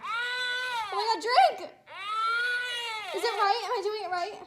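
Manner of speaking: high, loud frantic questions. Real, not acted. Teen mom trying to feed a crying baby, frantic.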